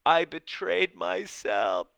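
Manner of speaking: slow, high, tearful mock sob; retold bawling. Woman realizing she betrayed herself, slow and tearful. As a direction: let it crack as the realization lands. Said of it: "She starts bawling".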